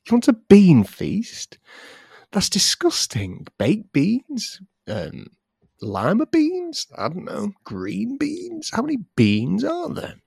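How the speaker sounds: high-pitched disbelieving questions; hammed-up baffled disgust; mock incredulity. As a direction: swoop way up on each kind of bean. Mock disgust at bean feast request.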